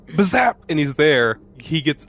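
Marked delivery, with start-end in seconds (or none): 0.1-0.6 mock zap sound. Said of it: "he goes".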